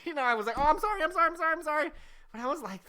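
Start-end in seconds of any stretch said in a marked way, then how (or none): 0.6-1.9 high, tumbling string of apologies; re-enacted panicky sorry; frantic apology. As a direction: beg pardon over and over, voice way up. Repeated apology to the aunt.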